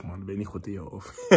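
usual speaking voice

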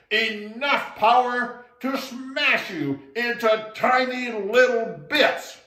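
booming, slow, loud spirit threat; loudest voice. Acted. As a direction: thunder the threat from the hollow tree. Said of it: "said in his loudest voice".

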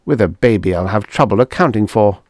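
usual speaking voice

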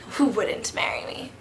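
usual speaking voice

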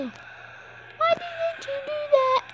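hammed-up sob at the player; mock wail. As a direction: wail it like a betrayed fan. Player misses, gamer despairs.

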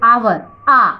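"आवर" with no h, then "आ" alone; silent H demo. Shown as a demonstration. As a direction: isolate the opening "आ" of "आवर". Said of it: "हमारा sound क्या हो रहा है". ("आवर" का शुरुआती "आ" अलग सुनाना)

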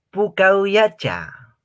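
high, slow, loud teasing; mock sweet tease; playful teasing. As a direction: tease a blushing girl. Teasing a blushing girl.

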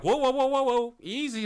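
loud, high imitated interruption; high mock alarm, easing off at the end; Andy. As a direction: cut in urgently to rein someone back. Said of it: "Andy's like".

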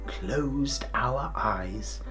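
ordinary delivery

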